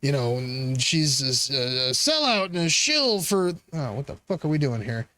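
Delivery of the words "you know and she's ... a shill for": lightly voiced pundit, pitched lower; mocking pundit. Streamer mocking critics, lower mocking pundit voice. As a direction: sneer out the talking point in a lower voice. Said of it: "They're talking about".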